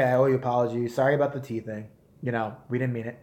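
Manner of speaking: ordinary delivery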